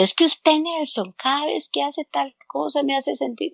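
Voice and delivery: mock blaming voice, rising high then falling soft; accusing demo. Shown as a demonstration. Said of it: "Que no es lo mismo que decirle".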